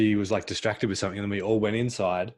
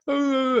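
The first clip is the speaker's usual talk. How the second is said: very high, louder mocking wail; mocking imitation; 7 dB louder than usual. Stepmom mocks the girl's crying, very high and loud. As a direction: mimic a crying child to mock her. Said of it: "her mum reenacted what she was doing".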